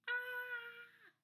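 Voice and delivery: high, held "ah" of pretend fear; mock scared scream. Shown as a demonstration. Teacher prompting the class to show scared, high mock scream. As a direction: cry out high as if frightened. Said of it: "Can you show me scared?".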